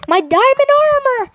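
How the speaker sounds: high, wailed exclamation; mock dismay. Steve gets back up after a hit, mock dismay. Voices Steve upset over armor.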